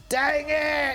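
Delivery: put-on high shouted Dang it, British accent; British-accented character shouting in frustration; British accent yell. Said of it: "then he'd yell".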